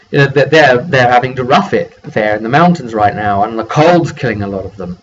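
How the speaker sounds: ordinary talk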